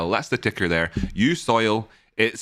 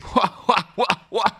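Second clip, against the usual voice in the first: high, put-on gloating cackle; mocking laugh; 9 semitones higher than usual. Trader laughing at the market's losers, mock gloating laugh. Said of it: "Laughing in the faces".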